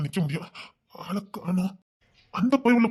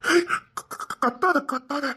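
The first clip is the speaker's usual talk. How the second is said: high, stammered plea for silence; panicked hushing; 8 semitones higher, much slower than usual. Acted. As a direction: stumble over the word in panic. Intruder stammers, begs her not to shout. (பதற்றத்தில் திக்கித் திணறி)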